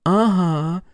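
high, sweetly menacing Masha; mock-tender. A narrator's reading. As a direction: coo it like a threat. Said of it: "ласково подтвердила она".

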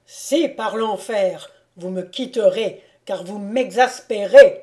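loud, mounting exasperation; exasperated insistence. A narrator's reading. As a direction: insist forcefully, bursting out at the end. Exasperated order to brother to leave.

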